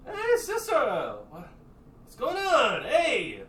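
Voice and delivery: high, loud, rowdy brother; high, loud drunk brother greeting; Lupe's brash voice. Acted. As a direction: call up at her, cheerful and loud. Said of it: "looks up in the sky and just goes".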